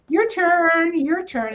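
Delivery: playful high singsong call; light invitation. Shown as a demonstration. Inviting your guidance to take its turn, light and playful. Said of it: "and just keep it light".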